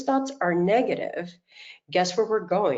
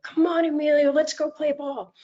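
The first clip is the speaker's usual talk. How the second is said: coaxing grandson; 9 semitones higher than usual. Retold or imitated. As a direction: sweet-talk a little boy into playing.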